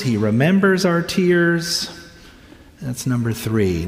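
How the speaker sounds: ordinary talk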